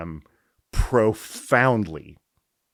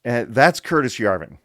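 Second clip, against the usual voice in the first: ordinary talk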